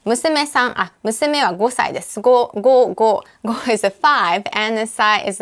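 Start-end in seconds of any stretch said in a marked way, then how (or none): none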